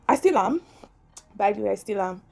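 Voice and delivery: ordinary delivery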